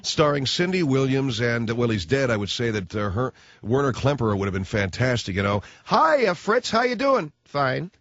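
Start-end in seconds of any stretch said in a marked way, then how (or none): 5.8-7.9 high chirpy voice; cheery sitcom neighbour. Retold or imitated. Neighbour greets Fritz, high and bright.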